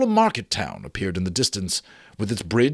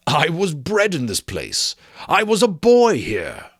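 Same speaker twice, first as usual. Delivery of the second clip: rising, louder with emotion; moved old man; 6 semitones higher, 4 dB louder than usual. A narrator's reading. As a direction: swell with childhood memory. Scrooge moved by memories.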